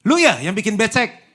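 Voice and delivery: loud, high accusing question; accusing snap. Acted. Spouse blaming partner over the wet bathroom, loud accusing. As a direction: blame them loudly for the wet floor.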